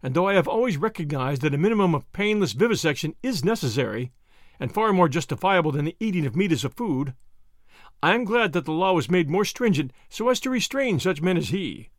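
ordinary delivery

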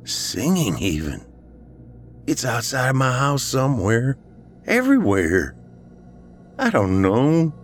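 high, strained distress; choking back a sob. A narrator's reading. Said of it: "he choked back a sob".

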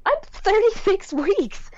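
high, slow and incredulous; can't believe they'd induce her this early; stunned disbelief. Retold or imitated. To the doctors, pregnant woman in stunned disbelief.

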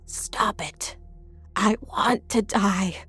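breathy, drawn-out wail. A narrator's reading. Mother while daughter bangs the window, wailing. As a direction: wail it weakly, in despair. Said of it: "Mom wailed".

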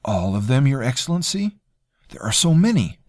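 a hint of a smug, polite cousin; smooth courtier. Terrell doubting.

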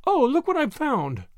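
high, lightly projected call from up ahead; bright-pitched call in a lightly voiced woman's part. A narrator's reading. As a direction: call back with news of a find. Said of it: "Miss Drexel called from the lead".

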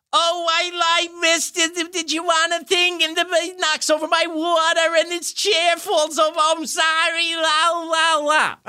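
high, far goofy Jerry Lewis voice; hammed-up klutzy Jerry Lewis; Jerry Lewis one. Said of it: "and he goes".

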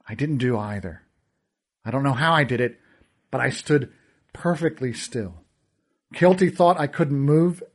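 plain reading voice

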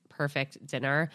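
ordinary delivery

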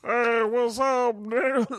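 high, slow, goofy cool-guy phone greeting; Dave Chappelle's Aquaman voice. Retold or imitated. Retelling Chappelle's Aquaman bit, goofy high phone greeting. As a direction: stretch it out like a lazy phone hello. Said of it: "and then he goes".

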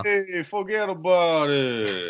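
high, drawn-out mock Italian mobster; playful Italian-American wiseguy impression. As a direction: stretch it slowly in a hammed-up Italian accent. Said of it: "with the stereotypical, uh, Italian accent".

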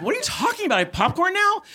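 very high, incredulous questioning; baffled disbelief. Retold or imitated. Patient baffled by the doctor's popcorn remark. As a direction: ask in squeaky disbelief. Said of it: "I was like".

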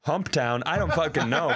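usual speaking voice

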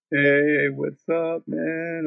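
mock woozy phone hello; feeble injured friend. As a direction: imitate a hurt friend greeting weakly.

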